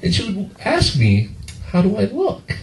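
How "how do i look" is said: higher, softer mom voice; coy mom asking for approval. Retold or imitated.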